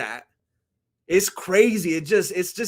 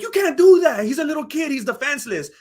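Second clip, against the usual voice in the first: high, indignant protest; mock outraged bystander, starting loud and easing off; George Costanza; 7 semitones higher, 5 dB louder than usual. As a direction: protest like an appalled sitcom character. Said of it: "George Costanza was like".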